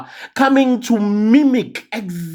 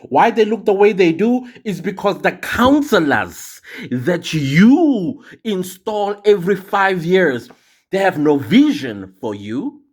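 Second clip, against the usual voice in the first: ordinary delivery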